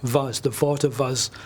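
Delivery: "was" and "Water" begun with V, like "vas" and "vater"; Germanic-style V on "was" and "Water"; W turned to V. Shown as a demonstration. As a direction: swap each W in "Water was" for a V. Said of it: "So instead of water, you say".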